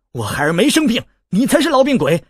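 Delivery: rising to a high, loud snap; angry retort. A narrator's reading. An angry mother snaps back at the boy who insulted her son. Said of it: "龟二娘怒道".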